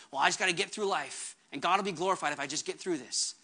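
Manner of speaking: resigned mumble. Retold or imitated. Pastor imitating a resigned churchgoer, low and soft resigned mumble. Mock resigned believer quoted.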